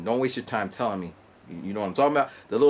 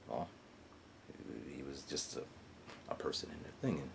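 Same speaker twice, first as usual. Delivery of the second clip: 1 whispered aside; 10 semitones lower, 20 dB quieter than usual. Real, not acted. Trailing off.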